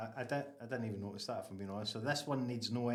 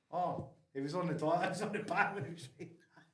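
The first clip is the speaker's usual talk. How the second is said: laughing imitation; 5 semitones higher than usual. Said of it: "then you're like".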